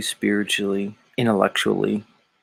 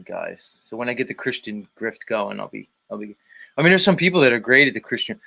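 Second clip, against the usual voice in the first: ordinary delivery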